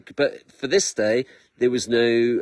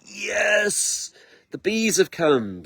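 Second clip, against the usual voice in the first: joyful yes; 7 semitones higher, much slower than usual. Retold or imitated. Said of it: "I'm like".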